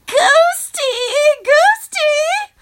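high sing-song call; eerie playful calling. A narrator's reading. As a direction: lure the ghost like a child. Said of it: "she calls out".

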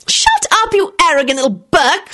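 high-pitched, contained screech. A narrator's reading. Rosie fed up with Timothy, screeching. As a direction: start very high and scold. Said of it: "screeched Rosie".

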